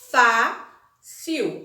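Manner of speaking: "Fácil" split, loud FÁ, pause, then cil; separated syllables. Shown as a demonstration. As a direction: break "Fácil" in two, hitting FÁ. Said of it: "Eu vou separar".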